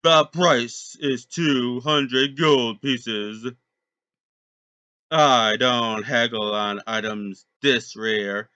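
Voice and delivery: a pirate captain refusing to haggle. Acted. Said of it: "talking in a pirate voice".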